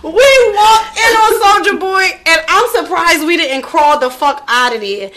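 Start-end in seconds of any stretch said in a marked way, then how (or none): 0.0-2.0 excited shout. Real, not acted. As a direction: shout it out like it's still a shock. Excited recalling [name] party.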